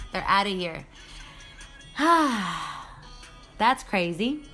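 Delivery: ordinary talk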